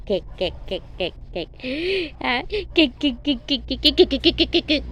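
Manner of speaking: repeated kek kek clucks; clucking chicken noise. Retold or imitated. Mother clucks like a chicken to amuse her baby. Imitating animal noise for child.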